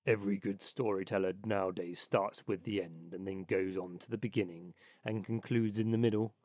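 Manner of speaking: low, self-important rat voice; low-pitched know-all animal character; opinionated Water Rat. Acted. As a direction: hold forth as a pompous, low-voiced storybook rat. Water Rat figure holds forth.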